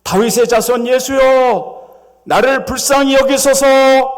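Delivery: high, loud, slow plea for mercy; desperate beggar calling out, loud and slow; crying out. Acted. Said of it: "이렇게 소리 지릅니다".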